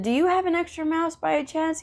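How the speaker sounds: lifted, sweetly pleading ask; high hopeful question. Retold or imitated. Asking the tech for a mouse.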